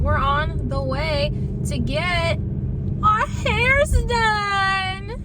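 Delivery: slow build to a high, loud cheer; excited. Real, not acted. Vlogger announcing a hair appointment, drawn-out excited cheer. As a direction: stretch the words out into a high, excited whoop.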